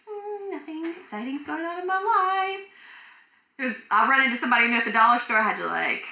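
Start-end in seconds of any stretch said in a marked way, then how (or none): 0.1-2.7 high, slow, sing-song casual voice; fake nonchalance. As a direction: pretend nothing happened, breezily. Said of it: "had to keep it together and be like".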